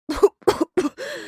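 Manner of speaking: acted coughing fit; rolling cough. A narrator's reading. Sick girl proving she is ill, coughing. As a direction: burst into a run of high-pitched coughs. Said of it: "Зашлась раскатистым кашлем девчушка".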